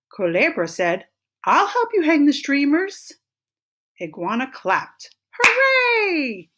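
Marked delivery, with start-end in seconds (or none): 1.4-3.1 high, loud, eager volunteering; storybook character's high, excited offer; keen offer. A narrator's reading. Culebra offers help with sudden keenness. 5.3-6.5 very high, loud cheer; squealed storybook hooray; joyful cheer. A narrator's reading. Iguana claps at offer of help.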